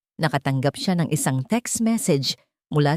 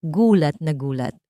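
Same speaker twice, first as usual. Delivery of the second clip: usual speaking voice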